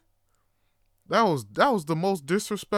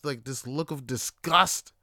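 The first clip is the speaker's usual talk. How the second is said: usual speaking voice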